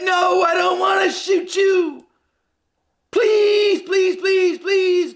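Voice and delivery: high, urgent mock police plea; pleading cop. Cop begging a man to drop the knife, high pleading. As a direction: beg him like a cop facing a knife. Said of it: "And they said".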